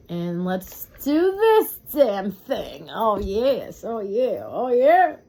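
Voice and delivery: loud, slow, gleeful build-up; too excited. Real, not acted. Reader gets hyped over the cards before the reading, loud and gleeful. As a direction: get pumped, let it rip. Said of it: "I got a little too excited".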